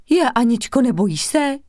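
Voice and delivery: alarmed for her friend; frightened. A narrator's reading. Girl frightened for her friend going abroad, alarmed. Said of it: "vyděsila se Dita".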